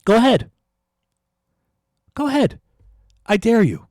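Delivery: loud, high challenge, really heated; a rant boiling over into a shouted dare; worked-up taunt. Real, not acted. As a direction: dare them at full voice. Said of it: "I'm getting a little worked up".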